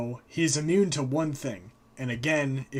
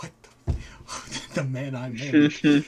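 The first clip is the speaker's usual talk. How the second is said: stunned Frigg; 3 dB louder than usual. Retold or imitated. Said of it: "And Frigg's like".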